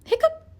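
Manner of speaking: high squeaky hiccups; three comic high hiccups; hiccup sounds. A narrator's reading. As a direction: pop each hiccup up high. Pete's hiccup voiced.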